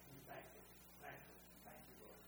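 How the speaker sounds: breathy unvoiced prayer of thanks; hushed whisper to the Lord.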